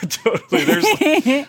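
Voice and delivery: laughing through the words; breaking into a laugh mid-word. Real, not acted. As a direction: giggly, pitch jumping up.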